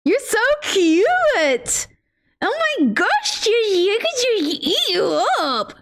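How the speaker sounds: high, slow pet talk. Real, not acted. She coos to the pet sitting on his throne, high and slow.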